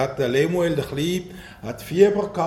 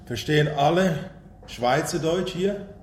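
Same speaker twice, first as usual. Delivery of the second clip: Standard German. Real, not acted. Preacher checks whether all understand dialect, in Standard German. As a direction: ask it in Hochdeutsch, not dialect. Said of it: "stelle ich um auf Hochdeutsch".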